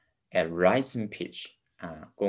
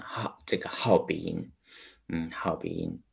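ordinary talk

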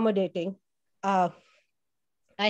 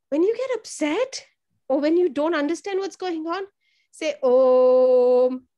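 lightly voiced yoga teacher, higher, ending on a loud drawn Om; calm instructor; 5 semitones higher, 5 dB louder than usual. Teacher imitating the nurses teaching kids to say Om. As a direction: gently coach the kids to chant Om.

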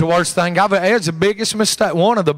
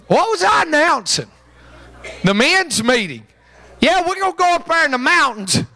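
high excited; 10 semitones higher than usual. Real, not acted. Joking announcement, high pitch.

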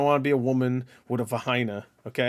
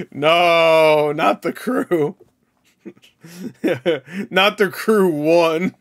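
mock despair; 3 semitones higher, 4 dB louder, much slower than usual. Mock despair over The Crew.

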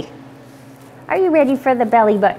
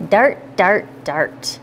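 each dart softer, lower; fading away; 5 semitones lower, 3 dB quieter, slower than usual. Acted. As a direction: let the words fade out. Rhyme repeats, fading out.

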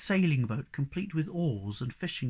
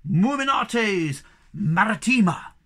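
slow, loud, higher-pitched proclamation; crying out; 6 semitones higher, 8 dB louder, slower than usual. A narrator's reading. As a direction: proclaim each Latin word grandly. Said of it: "cried the Hemulin".

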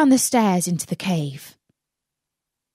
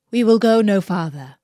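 false uncle; 5 dB louder than usual. Acted. Magician halts at the mountain.

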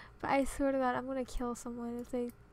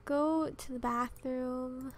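ordinary delivery

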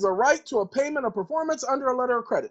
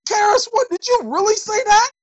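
much higher put-on voice of outrage; mock shock; 11 semitones higher, 3 dB louder than usual. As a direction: gasp it like an offended listener. Shocked imagined listener.

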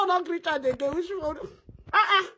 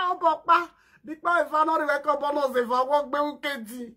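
usual speaking voice

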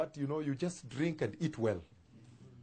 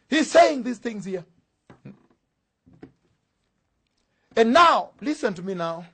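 loud, high-pitched sermon proclamation; ringing pulpit declaration; full-force preaching; 7 semitones higher, 14 dB louder than usual. Real, not acted.

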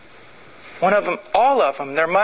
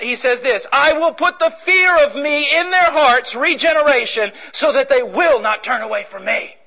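raised, ringing scripture reading; preaching scripture; 5 semitones higher than usual. Acted. Preacher reading Jeremiah to the church, ringing and raised. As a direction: proclaim the covenant to the congregation.

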